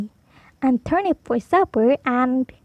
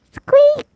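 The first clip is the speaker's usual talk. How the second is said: 1 high squeak; 13 semitones higher, 5 dB louder than usual. Acted. Mouse squeaks.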